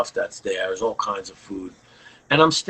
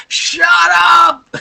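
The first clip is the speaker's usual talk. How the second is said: screaming it, high and much louder; yelling loud; 12 dB louder than usual. Retold or imitated. Bandleader screaming at his singer over the setlist. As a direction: blow up at him full force. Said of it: "he yells at me again, like, loud".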